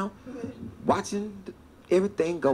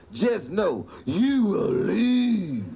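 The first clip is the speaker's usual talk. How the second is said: slow, drawn-out threat; a slow menacing warning from the lady; much slower than usual. Retold or imitated. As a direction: stretch out the threat slowly. Mrs Smith's threat quoted.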